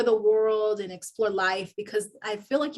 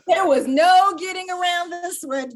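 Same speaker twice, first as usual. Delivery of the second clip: high laugh, words said louder; 9 semitones higher, 7 dB louder than usual. Real, not acted. Telling the host the audience noticed, laughing loudly. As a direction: laugh it out loud. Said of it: "far laugh".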